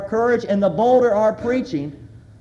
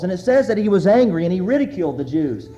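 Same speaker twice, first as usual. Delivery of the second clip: ordinary delivery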